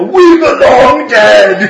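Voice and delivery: lightly hammed-up monster cry; loud, lightly mock-dramatic movie monster; monster movie line. Player voicing the monster at the switch, mock-dramatic.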